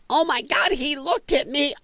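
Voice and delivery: high-pitched mock outrage at being noticed; mock distressed girl. Vlogger mocking women upset at being looked at, high-pitched mock distress. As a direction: put on a high, aghast girl voice.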